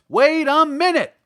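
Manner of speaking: loud, high call. A narrator's reading. Scarecrow calling out to stop the others, loud and high. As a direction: shout to stop them all. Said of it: "called the Scarecrow".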